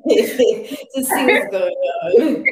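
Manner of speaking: talking through laughter; laughing. Real, not acted. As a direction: laugh while you say it. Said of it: "laughing mid line".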